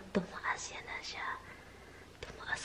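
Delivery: breathy whisper. Real, not acted. Whispering to herself about the plant that grew. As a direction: whisper it under your breath.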